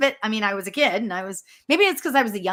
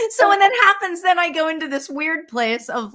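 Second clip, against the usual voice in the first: normal talking voice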